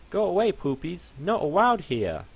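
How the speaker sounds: a little pony ordering the mess away; bossy fluffy pony. Acted.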